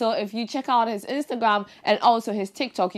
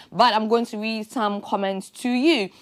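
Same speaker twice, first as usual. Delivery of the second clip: ordinary delivery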